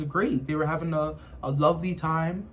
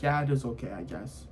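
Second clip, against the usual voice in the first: reluctant guy; 7 semitones lower, 8 dB quieter, faster than usual. Retold or imitated. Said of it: "he's like".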